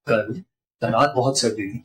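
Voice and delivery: कल followed by a pause, the rest low and soft; stress on कल. Shown as a demonstration. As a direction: isolate the word कल. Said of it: "हमारे stress से पूरी बात का मतलब चेंज". (कल के बाद रुककर ज़ोर)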